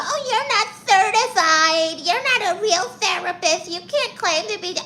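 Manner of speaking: high, mocking imitation of the naysayers; mocking the critics. Aunt mocking the commenters who doubt her, snide high mimicry. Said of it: "when you guys come in here talking about".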